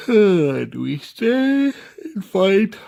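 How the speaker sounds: talking through a big yawn, slow and high; slow, loud yawn swallowing the question. Real, not acted. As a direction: let a real yawn stretch the words out. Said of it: "I don't know why I'm yawning".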